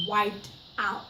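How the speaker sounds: usual speaking voice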